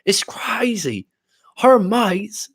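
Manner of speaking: high-pitched mock London accent. Host imitating a guy griping about her friends, London accent. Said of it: "a London accent".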